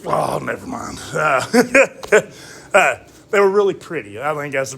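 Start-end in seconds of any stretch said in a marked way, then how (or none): 1.1-2.2 short laugh. Real, not acted. Laughs at losing his word.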